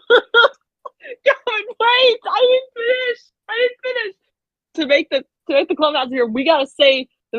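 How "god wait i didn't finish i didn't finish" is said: laughing. Real, not acted. Laughing, protesting interruption.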